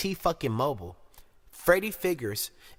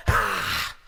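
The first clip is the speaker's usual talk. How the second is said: low, forceful burst of "ah"; loud chop cry; 7 semitones lower, 5 dB louder than usual. Acted. Commentator miming the guillotine falling, loud forceful cry. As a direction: punctuate the threat with a loud chop noise.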